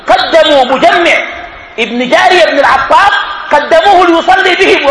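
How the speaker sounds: high-pitched, loud preaching; impassioned declamation. Real, not acted. Preacher telling who was put forward to lead prayer, impassioned.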